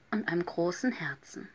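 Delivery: ordinary delivery, nothing marked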